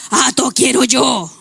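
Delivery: a hint of a pouting boy insisting; mock toddler demanding the toy car; sulky child, slow. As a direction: drag it out slowly like a sulking toddler. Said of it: "ahí empieza a cambiar el tono".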